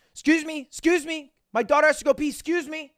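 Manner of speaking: high, loud, hurried imitation of a pushy mom. Mom pushing through the queue for her daughter, high and urgent. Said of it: "parent walk by with a kid".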